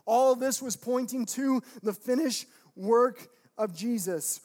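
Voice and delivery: loud, driving sermon climax; full-force preaching. Real, not acted. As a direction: proclaim it to the congregation with mounting force.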